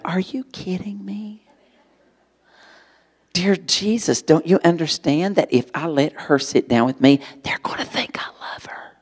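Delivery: hushed, urgent whisper to God; whispered prayer. Retold or imitated. Boy arguing with Jesus on the bus, whispered. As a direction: whisper your protest so no one hears. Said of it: "I'm saying".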